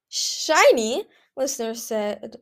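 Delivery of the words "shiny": high, loud incredulous echo; scoffing disbelief. A narrator's reading. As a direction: throw the word back in disbelief. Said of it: "snorting a fake laugh".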